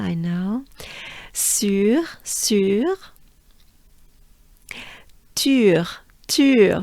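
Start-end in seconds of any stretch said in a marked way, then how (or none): 1.3-6.8 "Sur" and "Tur" with rounded French u; droopy-face vowel. Shown as a demonstration. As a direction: drill "Sur", then "Tur", lips pushed forward. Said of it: "we have the droopy face coming up twice".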